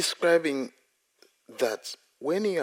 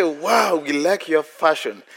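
mock admiring wow; 5 semitones higher, 7 dB louder, slower than usual. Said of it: "They say".

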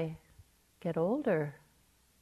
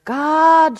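a full-voice cry for help, much higher and louder; desperate shout; 9 semitones higher, 14 dB louder than usual. Retold or imitated. Atheist dangling from a cliff screams for God. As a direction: yell it up the cliff with all your might. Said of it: "she shouted with all her might".

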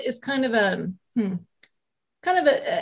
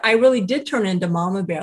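usual speaking voice

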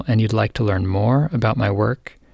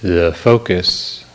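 guided meditation; 2 semitones lower than usual. Real, not acted. Teacher easing a listener into the morning, calm guided meditation. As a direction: guide gently, unhurried, phrase by phrase.

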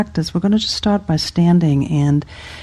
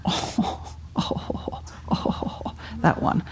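series of hushed breathy groans; kvetching sighs; 12 dB quieter, slower than usual. Shown as a demonstration. Teacher showing the class body kvetching, hushed groaning sighs. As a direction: let out small sighing "oh"s. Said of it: "a little bit of body kvetching, like".

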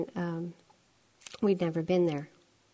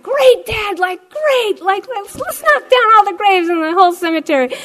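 much higher, louder mocking jeer; sarcastic taunt; 12 semitones higher, 14 dB louder than usual. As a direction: taunt Dad over the crash. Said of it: "I was just egging him on".

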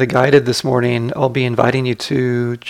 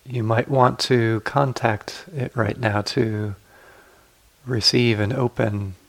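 guided meditation. Acted. Teacher guiding sitters to relax the body, calm guided meditation.

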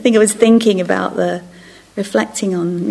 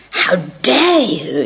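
mock indignation; 2 semitones lower, 5 dB louder, much slower than usual. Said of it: "wave of rage".

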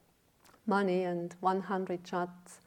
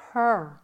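"her" alone, loud and falling; stress on "her"; 6 dB louder than usual. Real, not acted. Teacher on a daughter's claim, stressing "her". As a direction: hit "her" hard after a pause.

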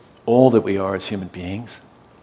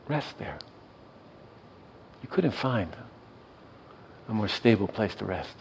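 usual speaking voice

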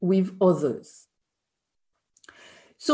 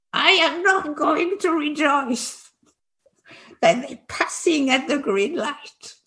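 higher-pitched mimicry of stubborn refusal; mock indignant refusal; 6 semitones higher than usual. French seniors rejecting the teacher's red-light example, mock indignant. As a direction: act out seniors flatly refusing to buy it. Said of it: "no way were they buying it".

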